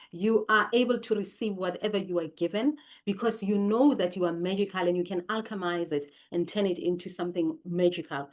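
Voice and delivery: ordinary talk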